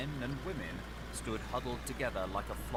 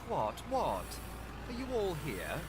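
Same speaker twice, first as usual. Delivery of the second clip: peevish; 7 semitones higher than usual. A narrator's reading. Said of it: "said the lawyer peevishly".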